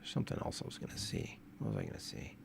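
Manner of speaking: whispered aside. Real, not acted. Wondering aloud to himself, whispered. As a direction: whisper it to yourself.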